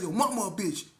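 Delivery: loud comeback insult; loud retort; angry comeback. Retold or imitated. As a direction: fire back at him. Insult back.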